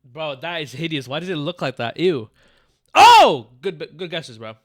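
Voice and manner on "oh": high, loud "oh!" outburst; sudden loud exclamation. Real, not acted. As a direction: burst out with a startled, high shout. Sudden reaction to game guesses.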